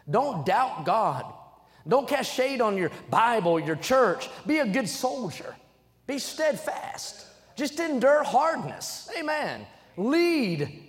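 rally the church, one short command at a time; stirring preacher's call, slow on steadfast; preaching exhortation. Acted.

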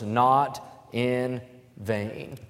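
"not in vain" drawn out very slowly, each word weighted; very slow, deliberate "not in vain"; stress on not in vain. Shown as a demonstration. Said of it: "and watch these three words".

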